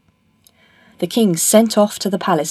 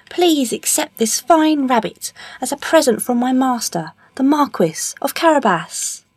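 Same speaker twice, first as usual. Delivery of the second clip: high-pitched, formal presenting voice; courtly cat; 7 semitones higher than usual. Acted. Puss offering the king a present, courtly.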